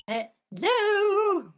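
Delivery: long, high, loud hello; calling out. Acted. Maya calling to an unresponsive Vasquez, loud call.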